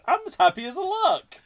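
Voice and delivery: put-on high, loud, cheery old-man voice; Harrison Ford impression. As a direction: chirp like a happy old Indy. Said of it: "played by Harrison Ford".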